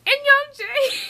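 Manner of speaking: very high, loud squeal of a name; piercingly high, thrilled outburst; fangirl squeal. Real, not acted. Announcing the favorite's arrival.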